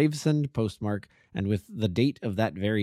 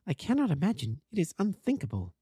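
higher-pitched woman's voice; woman character, baffled; 8 semitones higher than usual. Acted. Wife answering why he stays away, baffled and higher.

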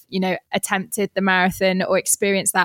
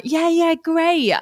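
high-pitched, sing-out mock agreement; breezy eager yes; 9 semitones higher, much slower than usual. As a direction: agree far too readily, pitched right up. Mocks own eager agreement.

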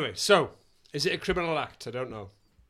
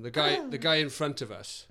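usual speaking voice

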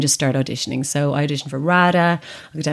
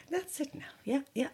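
dismissive mum; 9 semitones higher, 15 dB quieter than usual. Retold or imitated. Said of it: "she's like".

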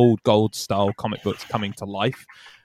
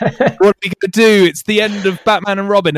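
higher, louder hammed-up despair with a laugh; mock panic; 8 semitones higher, 5 dB louder than usual. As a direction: play the doomed hero, laughing through it. Heroes' mock despair at the end.

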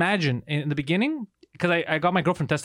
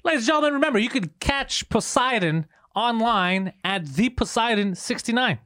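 announcer read; 5 semitones higher than usual. Acted. Show outro announcement.

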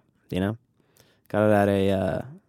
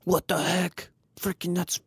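mock indignant fan; 7 semitones higher, 6 dB quieter than usual. Mock outraged fan at trendy shirts.

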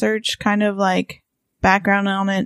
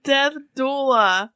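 slow, higher pronunciation demo; slow demonstration; 5 semitones higher, slower than usual. Shown as a demonstration. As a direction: say it slowly to prove your pronunciation. Slowly shows how she says it.

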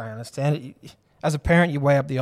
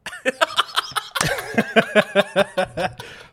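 laughing; 8 semitones higher than usual. Real, not acted. Laughing at mirror joke.

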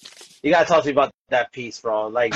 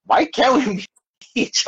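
laughing through the question, higher up; delighted giggle while asking; 10 semitones higher than usual. Real, not acted. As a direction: breaks into a laugh mid-line. Excited, laughing mid-question.

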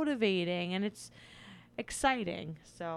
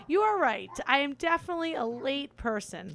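ordinary talk, nothing marked

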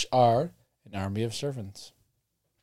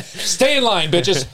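stern commanding; 6 semitones higher, slower than usual. Acted. Acting goddess ordering kids.

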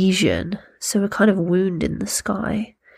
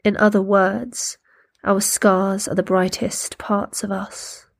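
poem reading. Acted. Reads a poem aloud.

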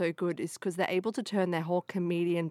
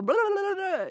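high babbling; 13 semitones higher than usual. Retold or imitated. Mocking Dan Aykroyd's babble.

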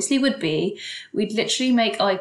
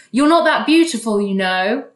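sneering man; 2 semitones higher, 7 dB louder than usual. Retold or imitated. Rude man belittling the woman, mock sneering. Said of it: "And then he goes".

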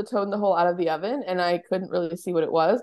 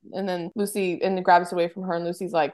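normal talking voice

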